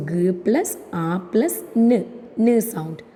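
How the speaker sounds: G, A, N as "guh, ah, nnh"; sounding out gun. Shown as a demonstration. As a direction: say sounds, not letter names, for G, A, N. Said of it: "together give you the word". (G, A, N "ഗ്, അ, ന്" ആയി)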